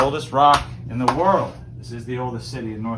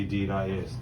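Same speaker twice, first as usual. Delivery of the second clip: clipped-front Newfoundland "Deed I is"; Newfoundland dialect; 5 semitones lower, 6 dB quieter than usual. Shown as a demonstration. Host teaching visitors the phrase in Newfoundland dialect. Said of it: "But in Newfoundland, we just say".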